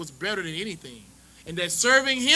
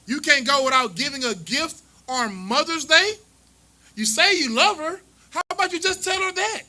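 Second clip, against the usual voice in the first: preacher rebuking the congregation, voice climbing higher; rising preacher challenge; 4 semitones higher than usual. Acted. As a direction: scold the congregation from the pulpit.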